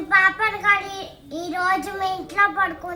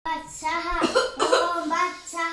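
slow low intoning; 3 semitones lower, 5 dB quieter, slower than usual. Acted. Mock priest intoning.

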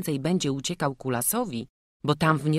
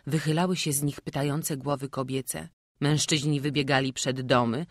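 usual speaking voice

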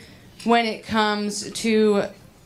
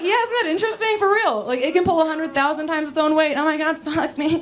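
high, fast, excited gushing; comic flirt gushing over a fact; giddy; 7 semitones higher, faster than usual. Retold or imitated. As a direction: gush about it breathlessly high. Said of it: "I'm like".

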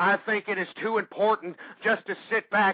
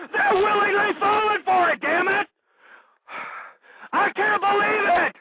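much higher, louder disbelieving outburst; outraged shout; 10 semitones higher, 3 dB louder than usual. Real, not acted. Host raging at the American public, angry shout. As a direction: explode in disgust. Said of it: "my blood is boiling".

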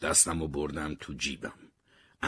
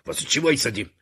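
raised, higher-pitched irritated shout; angry yell; 6 semitones higher, 6 dB louder, faster than usual. A narrator's reading. Harry yells at his companion as the elevator stops, angry. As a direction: yell the question, loud and high. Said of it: "هری سرم داد زد".

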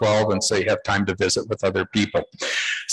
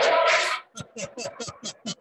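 high laughing burst; 10 semitones higher, 6 dB quieter than usual. Real, not acted. Laughing at repeat winner.

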